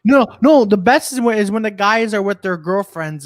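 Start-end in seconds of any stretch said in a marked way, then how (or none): none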